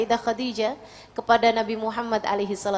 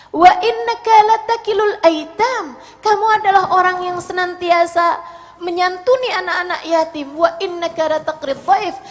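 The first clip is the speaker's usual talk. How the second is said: raised, ringing preacher's delivery; impassioned reassurance; 7 semitones higher, 9 dB louder than usual. Acted. Khadijah tells Muhammad he won't be harmed, impassioned and emphatic. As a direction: declare it with loud, high conviction.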